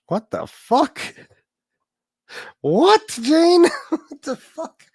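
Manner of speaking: high, loud incredulous what the fuck; shocked disbelief. Real, not acted. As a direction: react in stunned outrage to a chat comment. Reacting to a shocking comment.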